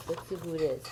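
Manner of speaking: plain reading voice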